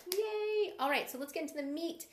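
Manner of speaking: high, loud cheer; excited. Real, not acted. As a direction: pump up the energy. Cheering before main content.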